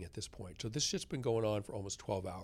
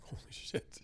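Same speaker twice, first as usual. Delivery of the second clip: awed whisper; 9 dB quieter than usual. Retold or imitated. Awed reaction to nurse.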